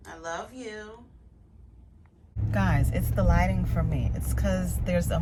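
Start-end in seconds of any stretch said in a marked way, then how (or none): 0.1-1.0 soft tender goodbye. Real, not acted. Leaving her dog, soft tender goodbye. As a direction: whisper it lovingly.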